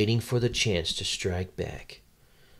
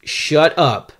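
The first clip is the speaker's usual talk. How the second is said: loud, higher interjection; mock-annoyed outburst; 6 semitones higher, 9 dB louder than usual. Real, not acted. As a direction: cut off the interrupter loudly. Snaps at the interrupting co-host.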